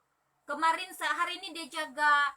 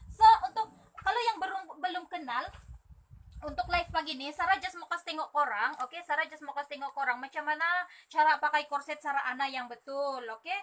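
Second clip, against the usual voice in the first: ordinary delivery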